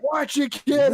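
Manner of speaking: high, loud hammed-up warning; mock movie yell. Imitating Home Alone Two park stranger, yelling. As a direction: holler it like a movie street character.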